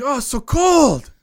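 high, loud mimicked African accent; mock African accent. Cameroonian grad shivering at the door, imitating his African accent. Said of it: "in his accent".

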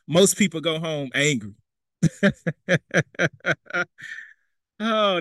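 normal talking voice

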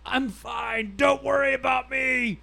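high, loud mock yell; hammed-up, high-pitched scream. A narrator's reading. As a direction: bellow it like a bumbling man insisting he's okay. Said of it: "He goes bumbling up the aisle screaming".

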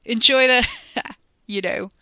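gloating yell with a chuckle; taunting shout. A narrator's reading. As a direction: call it out mockingly, then laugh. Ricky taunts Wes over the racket.

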